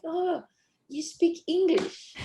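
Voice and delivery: high, surprised tourist voice; surprised quote. Retold or imitated. Locals marvelling at her English, high surprised mimicry. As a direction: mimic strangers amazed she speaks English. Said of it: "people always keep saying".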